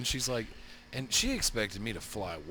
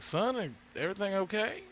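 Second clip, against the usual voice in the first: concerned question; 9 semitones higher than usual. Retold or imitated. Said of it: "I'm like".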